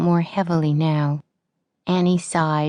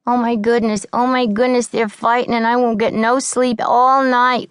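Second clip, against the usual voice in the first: higher, louder frightened whine; whining; 8 semitones higher, 5 dB louder than usual. A narrator's reading. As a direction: fret and whine at the fight. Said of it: "whined Tessie".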